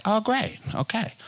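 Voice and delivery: sarcastic. Retold or imitated. Sarcastic reaction to her friends.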